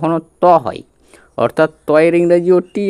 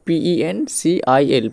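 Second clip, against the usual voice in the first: পিইএনসিআইএল spelled letter by letter, slowly; slow spelling out; much slower than usual. Shown as a demonstration. Teacher spelling পিইএনসিআইএল letter by letter for pupils. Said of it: "pencil বানানটার দিকে আগে তাকাও".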